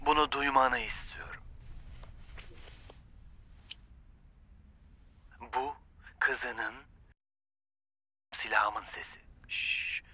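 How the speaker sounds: soft, threatening hush; menacing whisper. Acted. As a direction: hush them with a quiet threat. Gunman hushes, menacing low voice.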